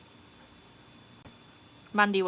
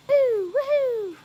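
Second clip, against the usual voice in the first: slow, high drawn-out woohoo; slow cheer; 6 dB louder, much slower than usual. Real, not acted. Streamer celebrating fifty likes, slow high cheer. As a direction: stretch the cheer out long.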